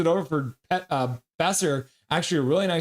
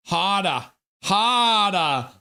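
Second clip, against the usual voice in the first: put-on Brooklyn accent, building louder on the second harder; 4 semitones higher, 4 dB louder, much slower than usual. Answering a chat request for more harder in a Brooklyn accent. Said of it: "harder and harder in that Brooklyn accent".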